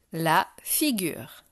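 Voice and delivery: slow dictation with a pause after "La"; article, pause, then the word. Shown as a demonstration. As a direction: dictate it slowly for a pupil to write. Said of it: "Je vais dire des mots".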